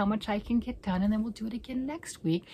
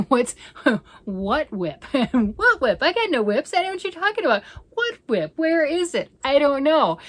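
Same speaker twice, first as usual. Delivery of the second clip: laughing, loud, higher playful denial; laughing mock denial; 7 semitones higher, 9 dB louder than usual. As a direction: pretend innocence through laughter. Mock denial of having whips.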